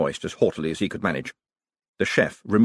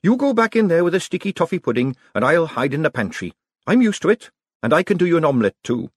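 put-on a girl's voice, raised in pitch, brisk and practical; a kitchen girl handling a familiar crisis, higher; young servant girl, matter-of-fact, higher; lightly voiced young girl; 8 semitones higher, 4 dB louder than usual. A narrator's reading. Narrator voices young Peggy.

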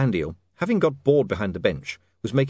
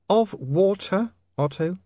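high, slow lady's voice; kindly young woman; 9 semitones higher, slower than usual. Acted. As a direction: add it carefully and slowly, pitched high. Sacharissa corrects herself.

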